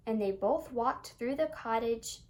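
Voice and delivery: ordinary delivery, nothing marked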